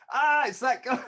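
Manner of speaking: loud, high exclamation breaking into laughter; delighted recognition. Retold or imitated. Friend in the booth recognising the doorman, delighted. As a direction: shout it out on spotting him.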